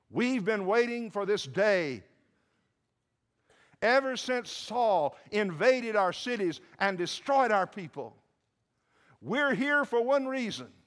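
high, loud, full-throated outburst; vengeful shout. Acted. Gibeonite crowd answering why they came, vengeful shout. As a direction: hurl out the long-held grievance.

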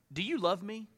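soft, hushed asking; gentle question. Retold or imitated. Jesus quietly asking Peter, soft and searching. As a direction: ask it quietly, almost private.

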